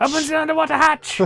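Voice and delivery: high loud villain shout; shouted villain order. Retold or imitated. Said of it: "gave him an evil voice".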